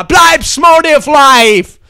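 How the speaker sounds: hammed-up slow bellow; drawn-out shout. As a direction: drag out the slogan as a yell. Catchphrase yelled slowly.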